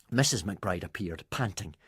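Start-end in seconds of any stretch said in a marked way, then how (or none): none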